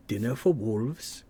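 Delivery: a hint of British accent on the end; lightly British slow ending; slight British accent. Redoing a Conan line, a hint of British accent. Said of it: "I put a British accent at the end".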